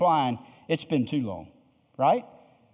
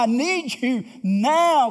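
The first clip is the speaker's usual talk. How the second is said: slow, higher, earnest plea to God; pleading prayer; 5 semitones higher, slower than usual. Retold or imitated. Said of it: "A request from God".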